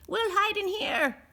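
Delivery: a small hopeful goblin asking. A narrator's reading. Goblin voiced in story.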